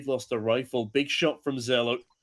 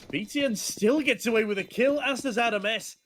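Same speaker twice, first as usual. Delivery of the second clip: excited; 8 semitones higher than usual. Real, not acted. Caster hypes a late retake.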